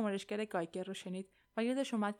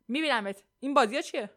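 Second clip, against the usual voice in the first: firm, louder and demanding; firm reply; 4 semitones higher, 10 dB louder than usual. A narrator's reading. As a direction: answer forcefully, voice raised. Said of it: "مورگان محکم جواب داد". (محکم و قاطع)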